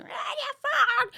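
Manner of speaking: very high imitated street yell; seagull-like squawk. Retelling the street fight heard from the bedroom, seagull squawk. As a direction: screech it like a squabbling gull. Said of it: "flock of seagulls".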